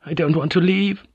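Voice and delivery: a hint of crying as she begs; understated tearful maid; tearful plea. A narrator's reading. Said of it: "The tears began to flow".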